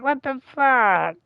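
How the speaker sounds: slow, drawn-out baffled mom; mock stunned mother walking away. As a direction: drag it out, totally thrown. Mom walks out confused.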